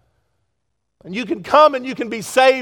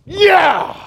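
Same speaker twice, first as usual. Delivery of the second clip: high, loud elated yeah; joyful yeah; 9 semitones higher, 5 dB louder than usual. Retold or imitated. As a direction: cheer it like a win. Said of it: "I'm sitting there going".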